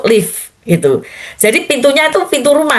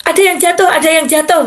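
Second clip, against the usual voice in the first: mildly alarmed bystanders, higher pitched; repeated higher-pitched cry, a touch of panic; retold commotion; 6 semitones higher, faster than usual. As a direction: raise the alarm like a flustered onlooker.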